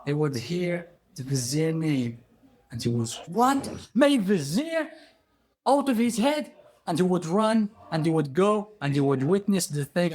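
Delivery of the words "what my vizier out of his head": high, incredulous outburst; acted royal outrage and shock; shocked king. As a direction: exclaim in disbelief, pitched way up. King learns vizier was killed.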